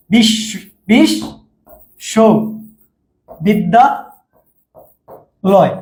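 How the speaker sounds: বিশ, সো, বিদ্যা, লয় with a long pause after each; split into separate chunks. Shown as a demonstration. Teacher imitating how many students divide the word, isolated chunks. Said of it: "অনেকে বলবে".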